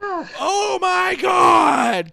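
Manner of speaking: high, loud hammed-up gasp; mock shriek. Host imitating Excalibur reacting to the explosion, mock shriek. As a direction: overplay a horrified commentator. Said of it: "you just hear Excalibur go".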